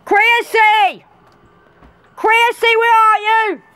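high, loud call across the yard. Acted. Mother calling for her missing daughter, loud and high. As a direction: shout her name out into the dark.

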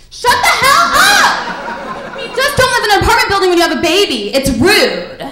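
high, loud screamed outburst; furious yelling. Acted. Neighbor to crying baby through the wall, furious yell. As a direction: explode at full volume, high then rushing on.

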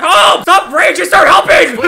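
high, loud cry for help; urgent shout. Real, not acted. Player pleading with teammate to help, urgent shout. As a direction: yell at a useless teammate.

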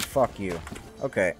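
usual speaking voice

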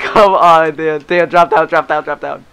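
loud urgent pleading; panicking gamer. Real, not acted. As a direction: shout the orders, urgent. Begging teammate.

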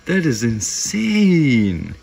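stunned exclaim. Real, not acted. Laughing reaction to tarantula.

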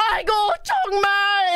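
put-on scolding Korean ajumma; mock yelling. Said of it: "like, yelling at us".

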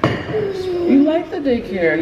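very high, very slow, sing-song coaxing. Real, not acted. Mom soothing crying toddler.

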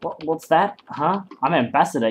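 ordinary delivery

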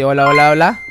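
loud hello to the street; calling out. Real, not acted. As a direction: call out to passengers. Calling out to passengers. (llamando en voz alta)

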